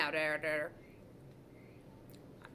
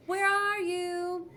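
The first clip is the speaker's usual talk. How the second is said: higher, louder calling; teacher calling for a hiding boy; 11 semitones higher, 5 dB louder, much slower than usual. A narrator's reading. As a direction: search the halls calling his name.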